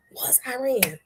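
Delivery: whisper. Real, not acted. Whispered reveal.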